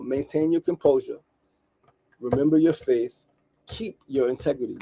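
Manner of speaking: measured mantra with pauses between phrases. Shown as a demonstration. Podcaster reads the mantra for listeners to write down, slowly. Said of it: "Let me read it slower".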